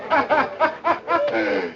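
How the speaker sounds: party laugh. Acted. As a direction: laugh it up merrily.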